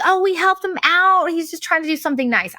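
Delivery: lightly voiced high, trusting Midwest nice; naive Midwesterner. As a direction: sound sweetly naive, mildly mocking herself. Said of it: "I'm from the Midwest".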